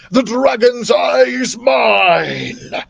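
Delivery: slow, high, loud triumphant shout; villain's cry. Acted. Ignatius Crook gloats in Daniel's dream, slow triumphant villain shout. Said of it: "cried Ignatius in my dream".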